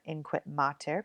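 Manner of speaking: plain reading voice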